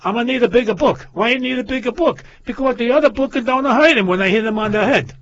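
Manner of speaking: put-on high, loud punchline in the old father's voice; punchline shout. A joke teller shouts the father's punchline to the doctor. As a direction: belt out the joke's last line. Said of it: "He says".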